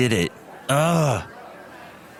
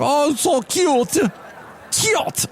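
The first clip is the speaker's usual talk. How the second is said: put-on very high, drawn-out Russian-accented coo; much slower than usual. Russian woman watches two dogs sniff, very high Russian-accented coo. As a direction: squeal it slowly like a doting Russian lady. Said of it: "this Russian woman's house".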